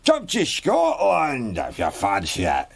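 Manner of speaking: slow, loud, drawn-out Scottish holler; aggressive local. Acted. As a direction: holler it roughly at a stranger. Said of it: "someone just saying".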